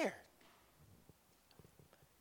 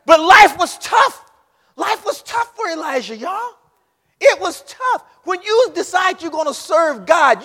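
high, loud fiery sermon; preaching at full force; 11 semitones higher, 15 dB louder than usual. Acted. Preacher warns the young folks of the fight, a loud fiery sermon.

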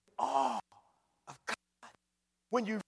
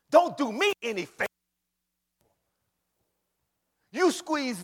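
raised, forceful divine rebuke; indignant God; 7 semitones higher, 7 dB louder than usual. Acted. Preacher as God scolding busy believers, forceful rebuke. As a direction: preach it high and loud, driving each claim home. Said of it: "God says".

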